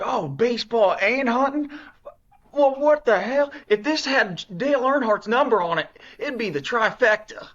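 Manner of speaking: high, loud Southern twang as dad; country accent dad impression. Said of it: "My dad has no accent".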